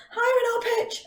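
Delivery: very high, sung-out voice. Shown as a demonstration. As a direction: swoop right up high as you say it. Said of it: "when my hand goes up".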